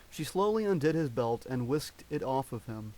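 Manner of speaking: ordinary delivery, nothing marked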